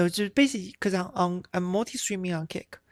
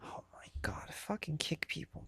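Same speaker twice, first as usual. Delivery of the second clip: whispered annoyed curse; exasperated whisper at the screen; muttered under breath; 11 dB quieter than usual. Real, not acted. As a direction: hiss it under your breath, fed up.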